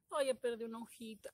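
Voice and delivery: usual speaking voice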